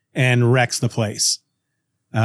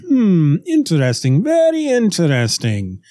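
put-on German accent. Doctor checking Lois out, German accent. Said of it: "a German accent".

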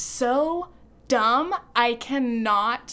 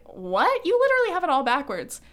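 incredulous retort; 5 semitones higher, 4 dB louder, faster than usual. Retold or imitated. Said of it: "I'm like".

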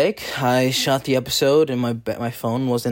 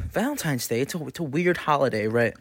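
ordinary talk

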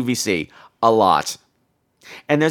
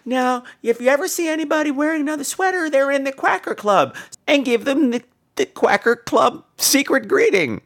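quoting the owner; 10 semitones higher than usual. Retold or imitated. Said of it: "she would always tell people".

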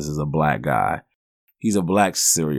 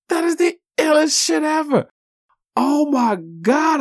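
very high, loud excited shout; 3 dB louder than usual. Real, not acted. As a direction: yell it out in delight. Overjoyed at the comic's ending.

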